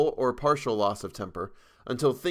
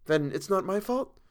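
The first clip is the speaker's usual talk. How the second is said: put-on higher young woman, hopeful question; lightly voiced model seeking reassurance; Tessie's voice; 9 semitones higher than usual. A narrator's reading. Model asks painter, higher hopeful voice.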